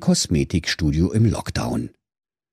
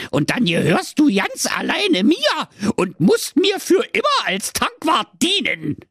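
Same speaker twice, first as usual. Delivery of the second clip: squeaky gnome with mock menace; a cheeky high threat; high sly dwarf; 14 semitones higher than usual. The dwarf warns Marco, high and mock-menacing.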